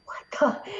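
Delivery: breathless whispered what the; stunned whisper. Retold or imitated. Retelling her awestruck reaction, in a whisper. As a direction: hush it out in disbelief. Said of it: "And I was just like".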